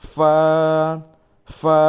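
loud ফা with a puff of air; breathy ফা. Shown as a demonstration. Teacher showing the airy ফা. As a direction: push air out on ফা. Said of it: "একটা বাতাস বের হবে".

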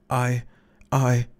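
a touch of stammering defiance; halting, rebellious. A narrator's reading. Crawley begins to protest before the crowd, halting. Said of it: "incoherently, rebellious".

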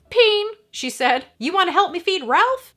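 put-on bright child voice. A narrator's reading. Lex telling Grant where Tim is, perky. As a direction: pipe up like an eager kid.

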